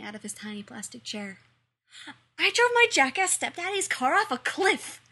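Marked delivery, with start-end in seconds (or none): 2.4-5.0 put-on high, loud boastful little boy; proud shout. A narrator's reading. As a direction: shout it proudly like an eager kid. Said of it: "he shouted proudly".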